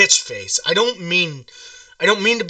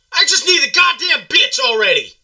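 higher, strained complaint; worked-up whining; 7 semitones higher than usual. Acted. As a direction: push the pitch up, frustrated. Caller getting worked up.

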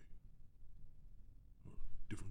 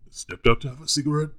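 emphatic; 6 semitones higher, 8 dB louder, faster than usual. Acted. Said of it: "I just wanna go on the record".

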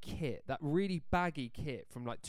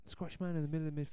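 put-on Scottish accent; 5 dB quieter, faster than usual. Echoing clue in Scottish voice.

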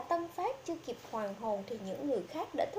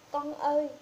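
put-on louder cry for her child; wailing; 5 dB louder than usual. A narrator's reading. Mother wailing for her dead daughter, louder. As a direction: let out a mild wail. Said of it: "Bà gào to".

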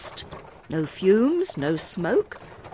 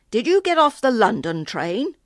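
put-on stationman's strange rural British accent, raised and louder; 5 semitones higher, 6 dB louder than usual. A narrator's reading. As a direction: question in an unfamiliar country accent. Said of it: "he asked in a strange accent".

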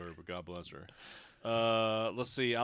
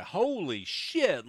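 drawn-out, higher, amazed exclamation; awestruck reaction; 6 semitones higher, 3 dB louder, much slower than usual. Retold or imitated. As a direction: stretch it out in retold disbelief. Retold amazement at first sight.

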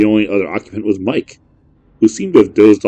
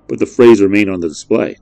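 ordinary delivery, nothing marked

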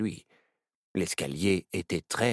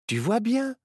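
Myrtille reassures; 4 dB louder, slower than usual. A narrator's reading. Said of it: "lui dit Myrtille".

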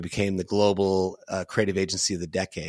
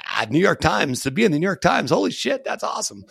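giddy excitement; 9 semitones higher, 4 dB louder than usual. Retold or imitated. Founders hearing the New York Times will cover them, giddy and high. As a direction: pitch shoots way up, giddy and gushing. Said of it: "you're like".

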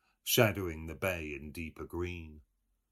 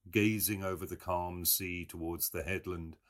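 ordinary delivery, nothing marked

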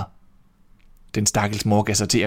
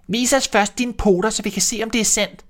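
put-on little kids shouting; 11 semitones higher than usual. A narrator's reading. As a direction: squeak it out like small kids refusing. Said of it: "råbte kidene".

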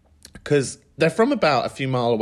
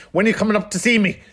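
higher, louder and quick, a mock grandma calling; grandma's nagging question; 9 semitones higher, 5 dB louder, much faster than usual. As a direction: hurry it out like a demanding old nan. Grandma voiced on phone.

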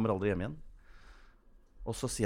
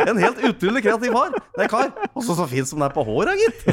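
loud, high cartoon character voice; high, boisterous character praise through laughter; Taubil; 11 semitones higher, 8 dB louder than usual. Cartoon character bragging about his cousin, loud high voice. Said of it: "der sier Taubil".

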